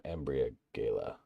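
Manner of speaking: Embriagala sounded out syllable by syllable; sounding out a name. Shown as a demonstration. Streamer reading a viewer's name, sounding it out. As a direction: work through Embriagala slowly. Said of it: "oh my god".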